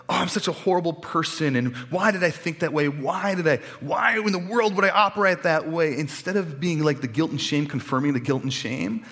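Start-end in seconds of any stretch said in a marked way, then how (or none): 0.1-6.0 mock self-berating, higher and louder; exasperated with yourself, building; self-reproach. Preacher voicing his guilty inner voice, rising self-reproach.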